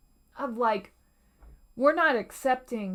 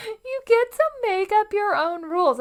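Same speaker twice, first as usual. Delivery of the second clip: excited laugh; 10 semitones higher, 4 dB louder than usual. Real, not acted.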